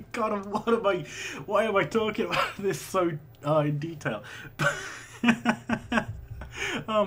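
high, loud talk through laughter; helpless giggles breaking into the words; real laughing. Real, not acted. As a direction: crack up at your own oversharing. Cracks up at own oversharing.

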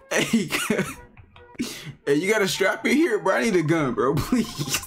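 high, laughing, shaky begging; nervous laughing plea. Real, not acted. Scared player begging the shopkeeper, high nervous laughter. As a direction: laugh through the plea for a gun.

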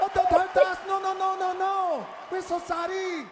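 high, loud pleading caricature; mock French general. French general begging the enemy not to hurt him, mock pleading. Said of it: "would have said this".

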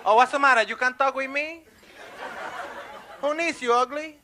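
high, affronted street-guy voice; snubbed catcaller. Acted. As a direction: take offense, then insult her back. Snubbed catcaller turns on woman.

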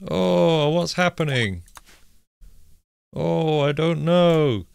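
drawn-out confused surprise; puzzled alarm. Real, not acted. Stream glitching.

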